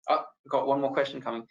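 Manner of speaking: normal talking voice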